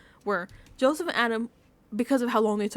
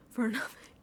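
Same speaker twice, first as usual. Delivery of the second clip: whispered laugh; 6 dB quieter than usual. Real, not acted. Laughing at the wasted emotional speech.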